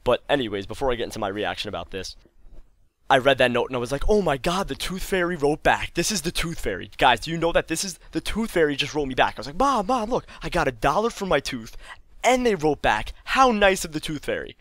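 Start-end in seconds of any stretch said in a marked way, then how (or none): 4.1-9.4 high, giddy disbelief; high-pitched retold excitement; excited child. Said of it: "like, all excited". 9.6-14.6 high, excited calling to mom; excited child. Retold or imitated. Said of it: "like, all excited".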